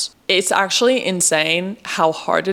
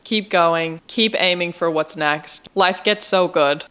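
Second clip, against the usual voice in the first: usual speaking voice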